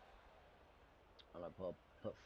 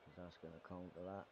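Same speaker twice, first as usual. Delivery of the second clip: ordinary talk, nothing marked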